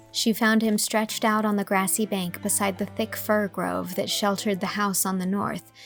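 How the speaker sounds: plain reading voice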